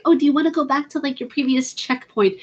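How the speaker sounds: mock game prompt. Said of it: "when they say".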